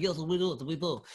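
lightly imitated Russian accent; mock Russian accent, quick agreement; a touch of put-on Russian accent. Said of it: "this accent is getting worse".

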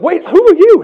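a surprised athlete; mock surprise. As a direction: act baffled by a stranger. Athlete meeting unknown cousins.

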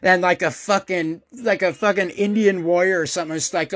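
ordinary delivery

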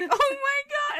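very high shocked oh my God; shocked gasp. Retold or imitated. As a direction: squeal it in shock. Sister realizes her slip.

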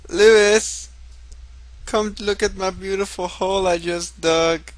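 high, put-on dwarf voice; excited dwarf calling. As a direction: call a friend over, high and proud. Calls out as dwarf from hole.

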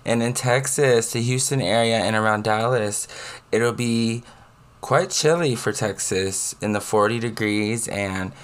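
upbeat weather forecast read, slowing on figures; weather report. Acted.

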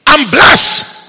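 loud, high, triumphant cry; shouted declaration. Shown as a demonstration. Preacher modelling bold faith talk for the church, shouting. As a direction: declare it with total conviction.

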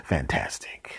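hushed, dry fantastic; whispered sarcasm. Acted. As the fly escapes, whispered sarcasm. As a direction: mutter it under your breath.

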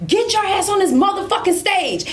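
retold yelling at the troops; sergeant major yelling. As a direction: chew them out like an angry sergeant. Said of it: "Like, just going off".

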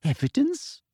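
sugary, high-pitched toad-like sneer; prim, falsely sweet official; Umbridge voice. Acted.

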